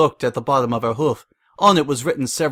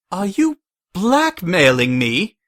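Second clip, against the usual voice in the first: higher, louder, slow indignant screech; 7 semitones higher, 4 dB louder, slower than usual. A narrator's reading. Rarity realising she's blackmailed, screeching. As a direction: shriek it in outrage. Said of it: "she screeched".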